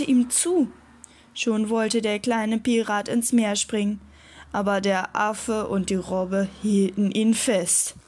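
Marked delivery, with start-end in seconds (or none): none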